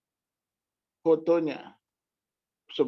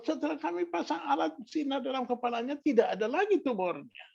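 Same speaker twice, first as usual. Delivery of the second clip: high-pitched doctor announcing the finding; imitating astonished doctor; 10 semitones higher than usual.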